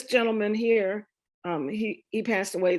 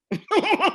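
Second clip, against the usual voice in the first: a sudden high burst of laughter; real laugh; 11 semitones higher, 5 dB louder than usual. Real, not acted. Telling the audience her family tree, a high bursting laugh. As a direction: laugh out loud at the photo.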